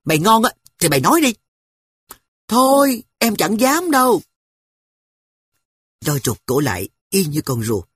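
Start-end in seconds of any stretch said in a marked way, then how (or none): 0.0-1.3 put-on fast, loud boyish snap; a boy flaring up at his sister; losing temper. Brother to pestering sister, fast angry boy voice. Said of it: "Tôi nổi nóng". 2.5-4.2 put-on high, loud little girl backing off; a small girl shrinking from the dare; timid girl. Sister to angry brother, high timid girl voice.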